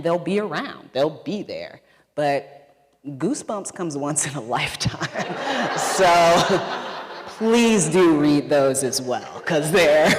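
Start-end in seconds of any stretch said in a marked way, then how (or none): 3.1-10.2 laughing through the words, getting louder; loud, slowed plea breaking into laughter. Real, not acted. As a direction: crack up while talking. Laughing at own joke to audience.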